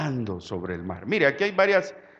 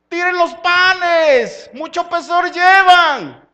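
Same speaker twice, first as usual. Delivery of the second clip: loud, high call, slow and stretched; shouting to the disciples; 13 semitones higher, 13 dB louder, slower than usual. Retold or imitated. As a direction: call them back across the water. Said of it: "Gritándoles".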